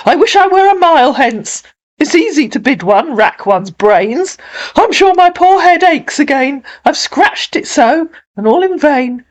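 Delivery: high-pitched theatrical lament; hammed-up exasperated mayor; harassed, self-pitying mayor. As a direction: wail like a flustered official at wits' end.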